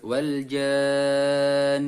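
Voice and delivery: very slow "wal jann", the "ja" drawn out long and the nun held; long six-count madd. Shown as a demonstration. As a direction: stretch "jann" far out, then hold the nun. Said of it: "janya dibaca enam harokat". ("jann" dibaca panjang enam harakat lalu dengung ditahan)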